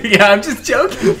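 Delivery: laughing. Real, not acted. Laughing at robot bit.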